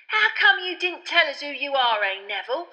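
high, loud grinning question; beaming Stan. A narrator's reading. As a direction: ask it beaming. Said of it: "said Stan, beaming".

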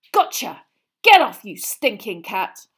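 loud snarl at the cat; triumphant yell. Acted. Ron yelling at Crookshanks after the catch. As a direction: shout it, then turn on the cat.

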